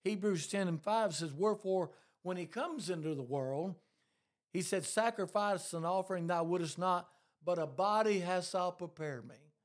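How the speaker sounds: loud, rising pulpit preaching; preaching at full force. Acted. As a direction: drive the scripture home to the congregation.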